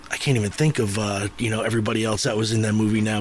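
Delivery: ordinary talk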